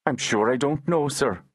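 put-on humble boy. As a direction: answer meekly, eyes down, as the lad. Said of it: "Ned replied with reflexive humility".

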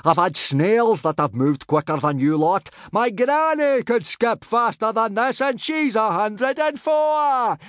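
high-pitched, drawn-out bellowed taunt; roaring. A narrator's reading. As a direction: bellow insults at the team, stretching the words. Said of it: "roared Gobber".

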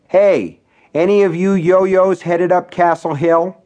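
calling out. A narrator's reading. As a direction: call out to the room. Calling out to bar customers.

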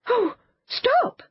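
high, startled cry to stop them; alarmed cry. A narrator's reading. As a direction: cry out in alarm, pitched well up. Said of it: "cried Anthea".

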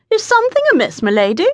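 high-pitched polite call. A narrator's reading. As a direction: call down a courteous question, pitch leaping at the start. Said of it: "the man called down".

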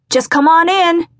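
high, loud call from inside the house; yelled. A narrator's reading. Someone inside calling to the visitor, loud high yell. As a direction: shout it through the door. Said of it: "someone yelled".

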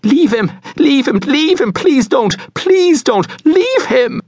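high, loud screaming plea; desperate cry. A narrator's reading. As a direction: cry out as a terrified mother. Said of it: "Then she cried out".

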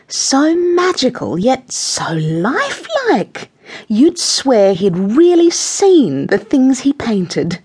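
high, slow, breathless gushing; hammed-up swooning art admirer; twittering. A narrator's reading. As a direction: draw out the words in affected rapture. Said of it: "Missus Tweedy twittering over the paintings".

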